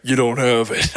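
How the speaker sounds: low-pitched giant impression; mock giant's deep voice. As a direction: answer as a huge deep-voiced man. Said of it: "came in in a deep voice".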